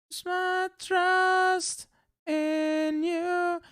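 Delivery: high, very slow mock-sweet; high drawn-out sarcasm; sarcastic sing-song. Real, not acted.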